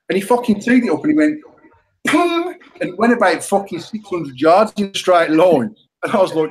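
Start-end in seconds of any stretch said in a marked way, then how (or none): 2.1-2.5 mock pum strike sound. Mimics the other man's drive.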